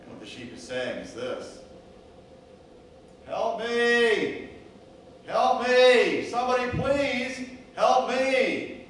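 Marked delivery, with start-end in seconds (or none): 3.2-8.8 high, loud desperate cries, last one drawn out slowly; bellowing for help. Acted. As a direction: yell like a hurt sheep with a broken leg. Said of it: "bellers out at the top of its lungs".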